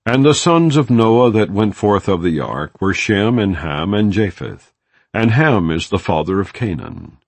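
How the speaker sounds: ordinary delivery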